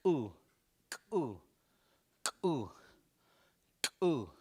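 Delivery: popped K, then "Ooh" just behind it; K melted into ooh. Shown as a demonstration. Teacher drilling the sound, K melted into "Ooh". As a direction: burst the K, let "Ooh" follow closely. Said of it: "then it's melted in there together".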